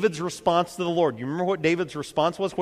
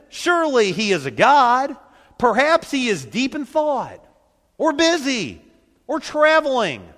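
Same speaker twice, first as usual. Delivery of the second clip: higher, sarcastic teasing; mocking taunt; 7 semitones higher, 5 dB louder than usual. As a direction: needle them with fake concern. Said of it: "Elijah began to taunt them".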